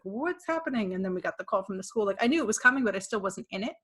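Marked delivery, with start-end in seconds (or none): 0.0-0.9 higher-pitched mock bewildered question; retold bewilderment. Shopper suddenly realizing the world is in chaos, bewildered. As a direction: act out your own baffled reaction.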